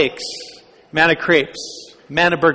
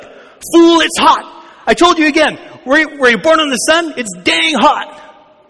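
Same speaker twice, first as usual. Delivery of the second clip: much higher, louder comic yell; exasperated shout; 11 semitones higher, 5 dB louder than usual. Retold or imitated. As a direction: blow up at the dumb question. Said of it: "picture the Israelites there in the desert".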